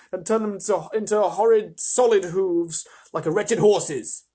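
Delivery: high, loud, tearful wail; a terrified fawn listing his punishments; crying again. A narrator's reading. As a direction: wail out each horror through tears. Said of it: "beginning to cry again".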